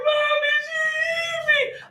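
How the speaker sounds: put-on very high wailing child voice; crying kid. Boy hit by a box crying to his mom, high wail. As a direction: wail it like a hurt little boy. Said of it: "He's like".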